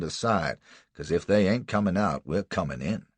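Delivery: loud, coarse backwoods threat; rough slave catcher. Acted. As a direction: lay down the law roughly. Buck's voice.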